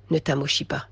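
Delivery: softly pressing the warning home; hushed repeat. Shown as a demonstration. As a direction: drop "Ne t'amochis pas" to an intimate near-whisper. Repeated exhortation softer.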